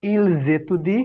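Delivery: "Il jeudi" linked as "il-zétudie"; a voiced z joining the words in "Il jeudi"; liaison. Shown as a demonstration. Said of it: "জয়ের মতো উচ্চারণ করব".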